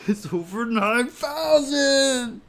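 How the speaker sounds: high, slow, loud shouted meme line. Pretend.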